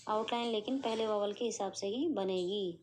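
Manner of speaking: ordinary delivery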